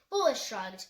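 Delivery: usual speaking voice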